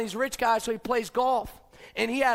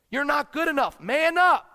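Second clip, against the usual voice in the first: louder, higher, barking it at him; harsh tough-love shout; 5 semitones higher, 7 dB louder than usual. Pretend. Motivational harsh voice of critic.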